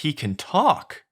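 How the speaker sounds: high, drawn-out, rising disbelief; gasping realisation, stretched and rising; astonished. Acted. Head guard astonished donkey talks.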